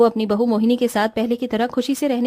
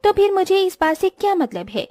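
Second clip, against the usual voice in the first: sulky, pampered daughter-in-law griping about the heat; haughty young bride fussing; spoiled rich bride; 6 semitones higher than usual. Acted. Vedika voiced by narrator.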